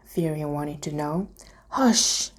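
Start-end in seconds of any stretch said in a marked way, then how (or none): 1.7-2.3 hushing. A narrator's reading. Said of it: "Luna said".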